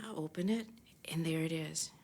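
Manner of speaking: whispered, barely voiced, full of anticipation; soft whisper of suspense; hushed whisper. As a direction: breathe it out as she opens the envelope. Opening the long-awaited script.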